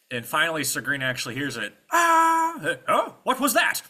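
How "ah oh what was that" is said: high, loud puzzled exclamation; startled knight. Acted. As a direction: break off dining, startled by a noise. Sir Green hears the scream.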